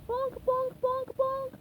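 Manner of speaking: high voiced bonks like knocking glass; knocking noise. SpongeBob knocking on Sandy's dome, high bonks. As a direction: say the knocks as a cartoon sound.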